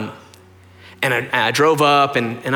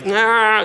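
high, drawn-out mock cry of pain; imitated pain groan; 8 semitones higher than usual. Dad in hospital with a kidney stone, imitated pained groan.

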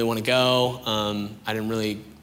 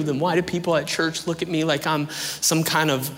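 higher, insistent questioning; pained challenge; 4 semitones higher than usual. Retold or imitated. Doubter unloading questions on his friend, pained challenge. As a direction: press each grievance as a demand for an answer.

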